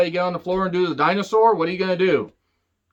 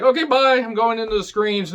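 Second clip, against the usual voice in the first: flippant goodbye; 6 semitones higher than usual. Retold or imitated. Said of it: "He's like".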